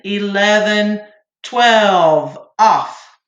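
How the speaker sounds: steady counted beats, one per second; rhythmic count-off. Shown as a demonstration. Choir teacher counting breathing beats for students, steady rhythmic count. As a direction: keep the beat for the class like a choir teacher. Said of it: "I'll count off".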